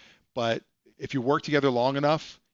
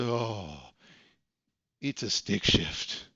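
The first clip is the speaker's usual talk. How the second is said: deflated admission; 5 semitones lower, 4 dB quieter, much slower than usual. Retold or imitated. Tony deflates admitting stick shift.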